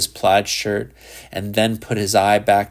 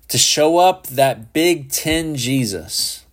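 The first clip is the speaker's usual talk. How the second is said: higher, slow, venomous hiss; hissed in fury; 5 semitones higher, slower than usual. A narrator's reading. Boy hisses at Shepard in fury, slow and higher. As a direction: spit the words with contempt. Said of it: "he hissed".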